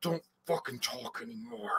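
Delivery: very soft, tense warning; suppressed anger. Retold or imitated. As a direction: barely let it out, seething. Retold warning with rising blood pressure.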